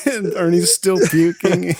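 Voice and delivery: ordinary delivery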